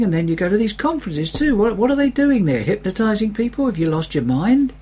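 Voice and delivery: high, incredulous mocking of churchgoers; mocking relatives. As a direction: scoff like a skeptical relative.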